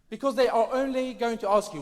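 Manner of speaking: normal talking voice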